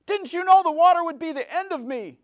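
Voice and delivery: high, loud wailing question; wailing, desperate. Acted. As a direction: wail at the girl in despair. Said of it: "in a wailing, desperating voice".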